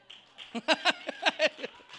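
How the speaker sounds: high, loud burst of laughter; hearty laugh. Real, not acted. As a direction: laugh heartily at your own joke. Laughs at own fried chicken joke.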